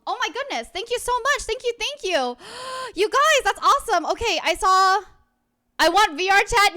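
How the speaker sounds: high, loud squealing thanks; excited. Real, not acted. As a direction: gush over the gift with real delight. Thrilled by viewer donations.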